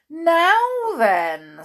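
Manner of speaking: loud, high, drawn-out telling-off opener; stern. A narrator's reading. Wilfred wagging his finger at Miss Bossy, stern. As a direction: pull them up with a warning. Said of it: "sternly wagging his finger".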